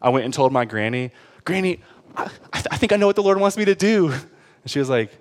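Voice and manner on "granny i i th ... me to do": higher-pitched, stammering announcement; acted eager youngster. Telling granny about God's calling, acted stammering excitement. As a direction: break the big news haltingly, pitched up.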